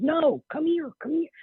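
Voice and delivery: mother refuses to wait while the medium eats, insisting, high-pitched; demanding mother. Retold or imitated. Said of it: "very demanding woman".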